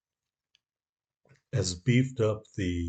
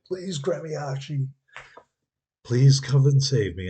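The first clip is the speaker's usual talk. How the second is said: higher, hammed-up desperate plea; mock pleading ally; 6 semitones higher, 6 dB louder than usual. Ally ship begging Gremiashi for rescue, mock desperation. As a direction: beg for rescue like a helpless sidekick. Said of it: "crying for help".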